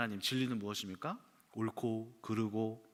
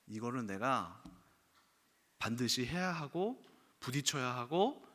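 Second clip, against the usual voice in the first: usual speaking voice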